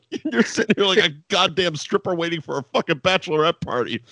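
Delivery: normal talking voice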